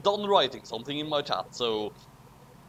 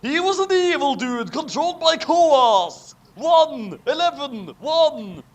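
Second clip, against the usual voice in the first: higher, louder chant; dramatic reveal; 9 semitones higher, 5 dB louder than usual. A narrator's reading. Narrator revealing the villain, high and loud. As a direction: cry it out like a big twist.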